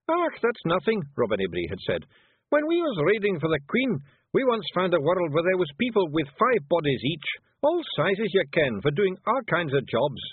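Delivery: high, loud broad Scots; tiny Scots warrior bragging; Scottish Feegle. Acted. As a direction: boast it in a rough Scots brogue.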